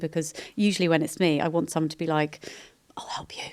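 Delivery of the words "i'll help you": hushed whispered offer. Retold or imitated. Tired mum whispering the help she longs to hear. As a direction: breathe it like a secret kindness.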